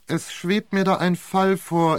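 ordinary delivery

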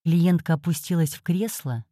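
ordinary delivery, nothing marked

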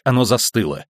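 ordinary delivery, nothing marked

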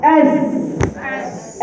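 "أس" with a long, soft, airy hiss; hissing S again. Shown as a demonstration. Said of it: "شفتوا الفرق".

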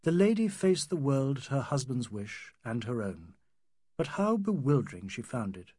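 ordinary delivery

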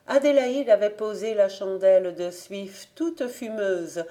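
usual speaking voice

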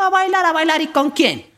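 voz del papá muy aguda, incrédula; sorpresa. Acted. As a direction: pregunta escandalizado, en agudo. Padre sorprendido ante la hija. (el papá incrédulo ante la hija, voz aguda)

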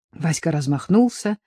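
usual speaking voice

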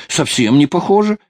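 high, loud, hurried protest; hasty reassurance. A narrator's reading. Sailor hurrying to reassure the offended girl, hasty reassurance. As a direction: insist on it, pitched right up. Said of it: "заторопился он".

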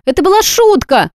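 high protesting exclamation; exclaiming. A narrator's reading. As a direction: blurt it out, backing away. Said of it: "воскликнула девица".

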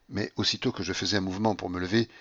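plain reading voice

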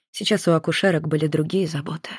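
ordinary delivery

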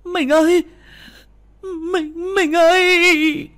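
very high, loud anguished scream. Acted. Wife shaking her collapsed husband, screaming for him. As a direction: shriek for your husband, drawing out the last call. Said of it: "Bà hét lên lao tới mà lay chồng".

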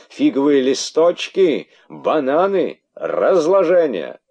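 high, loud outraged rant. Acted. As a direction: sputter with indignation. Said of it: "не находя слов от возмущения".

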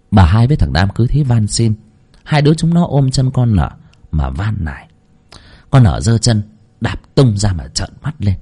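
ordinary delivery, nothing marked

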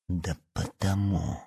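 slow, hushed spite; quiet, venomous answer; open malice. A narrator's reading. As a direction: draw it out softly with cold menace. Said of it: "слышалась такая откровенная злоба".

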